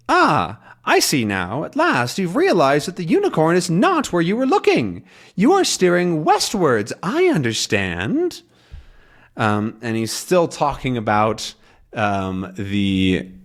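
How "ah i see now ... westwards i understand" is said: lightly voiced smug professor, pitched up; pleased old scholar thinking he is right; Calculus voice. Calculus misunderstanding the captain, smug and higher. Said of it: "And then he says".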